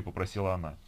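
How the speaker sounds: ordinary delivery, nothing marked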